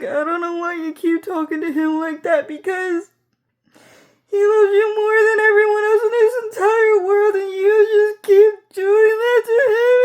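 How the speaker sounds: a high, crying plea that keeps climbing; imitated sobbing mom. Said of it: "was yelling at me".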